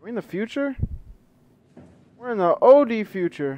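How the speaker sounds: high, loud disbelief; shocked. Real, not acted. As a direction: blurt it out in shock. Shocked at new scene.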